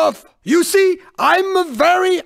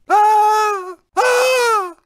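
high drawn-out "ah" of alarm; theatrical wail; alarmed cry; 6 semitones higher than usual. Acted. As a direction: cry out high as the car loses control. Racer cries out in danger.

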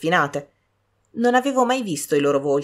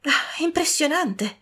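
impressed; 11 semitones higher, slower than usual. A narrator's reading. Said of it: "aggiunse quando ebbe finito".

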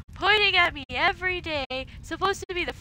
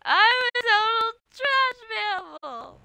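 mock crying quote; 8 semitones higher, slower than usual. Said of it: "It's like".

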